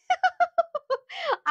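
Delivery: high-pitched burst of giggles; high laugh. Real, not acted. Seller amused by her own dialect attempt, high laugh.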